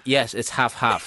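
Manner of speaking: put-on her mom's Chinese-accented English; slow, laughing "half half" in a Chinese accent. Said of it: "which is how exactly my mom say half and half".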